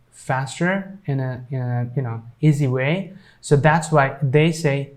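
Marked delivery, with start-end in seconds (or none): none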